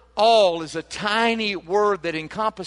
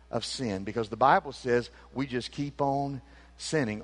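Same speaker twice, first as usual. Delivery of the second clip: ordinary talk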